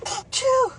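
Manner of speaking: sharp high sneeze; one big sudden sneeze; sneezing. Real, not acted. Sneezing fit continues.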